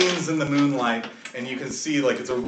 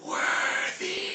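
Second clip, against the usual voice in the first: slow, drawn-out ghostly whisper; mysterious voiceless call; eerie whisper; 6 dB quieter than usual. Acted. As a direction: whisper it like a disembodied voice. GM voices unearthly voice heard by player.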